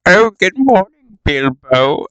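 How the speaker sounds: high, sing-song wizard hello; mock Gandalf greeting. Kid telling a story voices Gandalf, lightly mocking. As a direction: greet him like a chipper old wizard.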